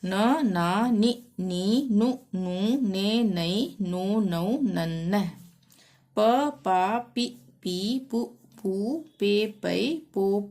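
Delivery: measured sing-song letter chanting; syllable drill recitation. Shown as a demonstration. Teacher reciting Hindi syllables for learners, sing-song drill. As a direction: recite each syllable separately with a lilt.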